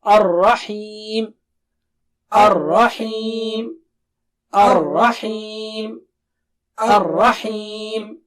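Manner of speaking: Ar-Rahim slow, thick ra and clear ha; slow teaching drill of Ar-Rahim; slow drilled word. Shown as a demonstration. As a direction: recite Ar-Rahim slowly and evenly. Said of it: "Let's take the word".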